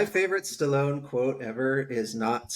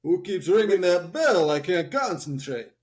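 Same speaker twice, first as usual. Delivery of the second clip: put-on louder, higher punch-drunk Rocky voice; a boxer too dazed to think, slurring; mock groggy Rocky complaining in the corner; dazed claymation Rocky; 5 semitones higher, 4 dB louder than usual. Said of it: "And he's all".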